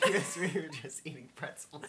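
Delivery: giggling through the words; laughing. Real, not acted. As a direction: let laughter break into the confession. Giggling over pretzel noises on mic.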